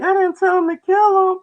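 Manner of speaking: very high, loud falsetto whine; whiny denying woman. Retold or imitated. Mocking the girl blaming her brother, high loud falsetto. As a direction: squeal the denial in a high girly voice. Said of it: "her brother, like".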